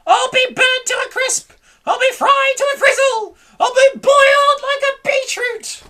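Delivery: high, loud rising rant; panicked wailing. A narrator's reading.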